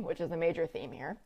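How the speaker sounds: usual speaking voice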